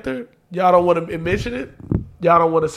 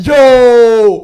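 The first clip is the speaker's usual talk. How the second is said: a sudden, much louder yo; 4 semitones higher, 10 dB louder than usual. Pretend. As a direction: call out across the room. Calling out across the room.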